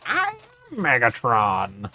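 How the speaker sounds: slow, soft mock villain line; lightly voiced robot villain boast; a hint of Megatron, slowed. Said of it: "Not in that voice too".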